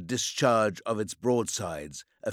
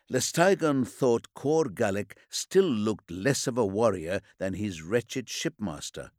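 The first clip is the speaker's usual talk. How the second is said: usual speaking voice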